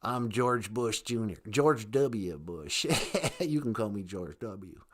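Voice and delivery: put-on sneaky, low frat boy with a snicker; hushed, deep, slightly evil son; George W. Bush. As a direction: speak low and soft, slyly misbehaving. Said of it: "he was a little more evil and a little sneakier".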